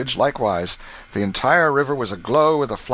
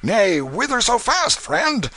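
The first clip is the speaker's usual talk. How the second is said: tipsy burly waterman; 11 semitones higher, 3 dB louder than usual. Acted. A drunken waterman stopping Canty in the crowd, jovial and high. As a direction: hail him like a merry drunk.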